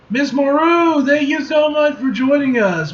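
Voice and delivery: high, excited host greeting; gushing welcome. Acted. Host welcoming Storm, gushing and high. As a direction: gush it like a starstruck host.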